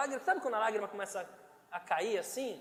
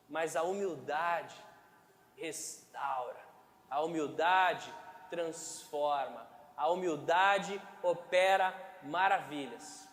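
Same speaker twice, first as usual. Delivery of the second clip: solemn preaching; 5 semitones lower, slower than usual. Acted. Slow solemn preaching on humility.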